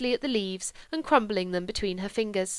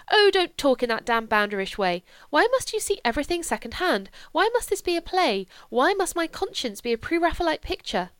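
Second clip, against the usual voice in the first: sharp, high exasperated rebuke; angry outburst; 7 semitones higher, 4 dB louder than usual. Acted. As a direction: lash out at him in frustration. She rebukes him angrily.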